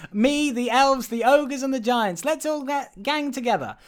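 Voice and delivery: high-pitched eager elf voice; rallying elf. Retold or imitated. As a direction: rouse the crowd as a pretend elf agitator. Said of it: "and then the elf, uh, is like".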